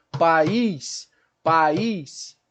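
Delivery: "País" stretched as pa-ÍS; hiatus demo. Shown as a demonstration. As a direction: split A from a long stressed I in "País". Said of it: "essas separações silábicas". ("País" separando as sílabas, pa-ís)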